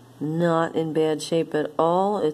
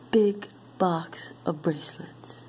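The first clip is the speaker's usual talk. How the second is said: whisper; 2 semitones higher than usual. Real, not acted. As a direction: breathe it, barely voiced. Unvoiced aside.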